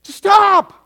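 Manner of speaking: loud, high, forceful order to stop; a pastor ordering someone to stop, loudly; firm stop command. Retold or imitated. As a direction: shout them down to stop. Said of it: "That's the pastor going up there saying".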